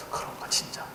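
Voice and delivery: whispered doubt; doubtful whisper. Retold or imitated. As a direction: whisper it to yourself, unsure. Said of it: "하다가". (형들이 의심하며 속삭이듯)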